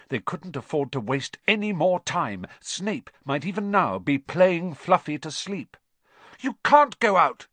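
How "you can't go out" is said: put-on high, pleading boy voice; anxious Neville. A narrator's reading. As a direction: plead with them to stay. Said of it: "said Neville".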